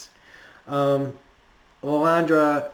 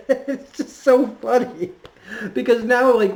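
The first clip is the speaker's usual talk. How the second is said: much higher, louder words through real laughter; giggling, much higher and louder; laughing; 10 semitones higher, 5 dB louder than usual. Real, not acted. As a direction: still cracking up, can't get over it. Cracking up after the scream.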